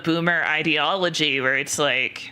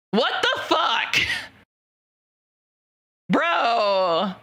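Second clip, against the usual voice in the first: high, slow disbelief; stunned what-the-hell reaction; shocked; 6 semitones higher, much slower than usual. Real, not acted. Reacting to something in chat, shocked disbelief.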